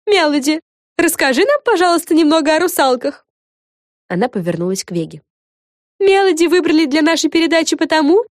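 high, loud, animated fairy voice; high-pitched fairy pitching the episode; eager fairy presenter. Acted. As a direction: enthuse in a high storybook character voice.

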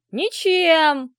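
high, loud, drawn-out shout; gleeful yell. A narrator's reading. Fathers answer the child's riddle, gleefully yelling. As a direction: crow it with obnoxious enthusiasm.

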